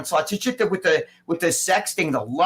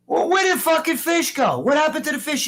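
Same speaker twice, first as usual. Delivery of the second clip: high, loud, outraged questioning; incredulous demand; 11 semitones higher, 5 dB louder than usual. Retold or imitated. Gym owner confronting sleepy Ronnie about the fish, incredulous demand. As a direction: act out confronting the culprit in disbelief.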